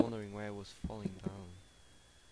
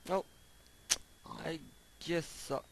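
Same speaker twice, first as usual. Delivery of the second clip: ordinary talk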